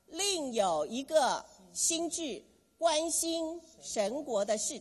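slow, rising chant of the motto; rhyming slogan. Shown as a demonstration. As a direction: drill the slogan slowly, every syllable. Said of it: "来说一次". (缓慢有力地领读口号)

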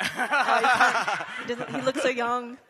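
cracking up mid-sentence; words tumbling out between laughs; laughing. Real, not acted. Joking about the pastor's youthful looks.

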